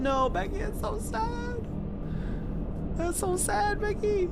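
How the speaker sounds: hammed-up sad meme voice; mock pity. Streamer mocking sympathy to a viewer, mock pity.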